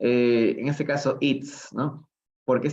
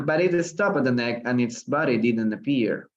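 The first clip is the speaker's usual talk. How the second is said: ordinary talk, nothing marked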